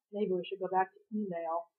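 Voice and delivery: quiet undertone. A narrator's reading. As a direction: say it half to yourself. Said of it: "Con added in an undertone".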